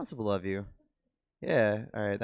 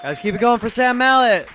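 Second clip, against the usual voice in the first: loud, high crowd-pumping call; hype shout; 11 semitones higher, 13 dB louder than usual. Real, not acted. Host calling the crowd to cheer Sam Mallet, loud hype shout. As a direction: fire up the room for the comic.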